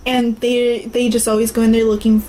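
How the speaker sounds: ordinary talk, nothing marked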